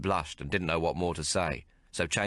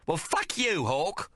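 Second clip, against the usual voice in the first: Maggie, much higher and harsh with rage; furious; 12 semitones higher, slower than usual. Acted. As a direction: spit it at him, wounded and angry. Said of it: "She was furious".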